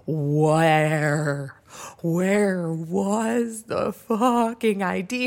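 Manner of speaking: loud, drawn-out acted question; incredulous demand. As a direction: stretch every word in exasperated disbelief. Acting demand to know where.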